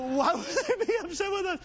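high-pitched, put-on voice for a mock puzzled question; comically high quoted protest; exaggerated repeat. Redoes the line after audience misses it.

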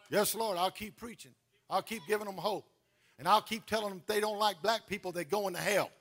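ordinary delivery

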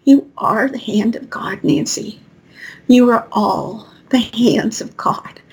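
slow hushed whisper as Spirit; Spirit's voice. Retold or imitated. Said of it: "Spirit said".

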